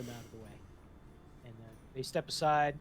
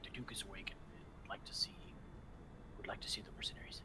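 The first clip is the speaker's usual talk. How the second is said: whispered in her ear; 5 semitones lower, 10 dB quieter than usual. Acted. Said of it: "bends his mouth down to Stephanie's ear and says".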